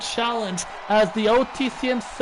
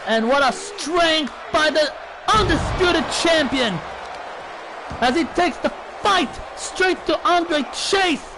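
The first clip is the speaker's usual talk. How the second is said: wrestling commentator; 4 semitones higher than usual. Real, not acted. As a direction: shout the big moves as they land. Live wrestling play-by-play climax.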